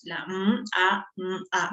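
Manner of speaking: "La M, A, M, A" one sound at a time. Shown as a demonstration. Teacher counts four sounds for students, sound by sound. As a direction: separate each sound of "mamá". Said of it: "ha tenido cuatro sonidos".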